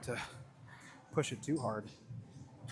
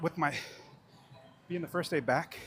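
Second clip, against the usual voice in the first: normal talking voice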